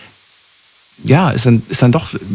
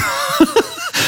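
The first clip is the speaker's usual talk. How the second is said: amused laugh; 5 dB quieter than usual. Real, not acted. Amused at American small talk.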